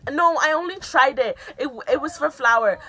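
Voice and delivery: high, loud panicked excuse; defensive blurt. Retold or imitated. Caught teen pleading with her parents, high and loud.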